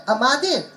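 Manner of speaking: "Amadev" with the middle syllable punched; stress on second syllable. Shown as a demonstration. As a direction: wrongly lean on the MA in "Amadev". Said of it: "each syllable has its own stress".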